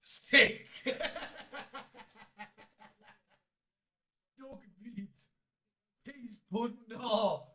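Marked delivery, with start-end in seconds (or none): 0.7-2.8 long, higher wheezing laugh; laughter. Real, not acted. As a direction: crack up helplessly. Laughing at a snack guess.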